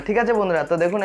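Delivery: normal talking voice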